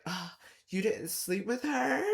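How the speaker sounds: high, mock-surprised girly voice; mock surprised girl. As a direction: squeak it out as the amazed heroine. Mimics heroine's surprised question.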